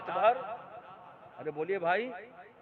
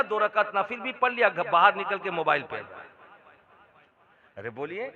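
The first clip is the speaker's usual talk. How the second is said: ordinary talk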